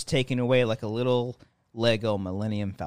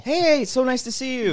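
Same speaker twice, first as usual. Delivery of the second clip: bright safe greeting; 11 semitones higher than usual. Shown as a demonstration.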